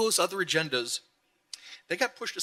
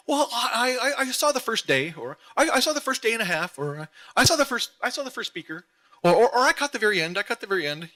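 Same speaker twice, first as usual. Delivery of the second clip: flustered husband impression; 8 semitones higher, 4 dB louder than usual.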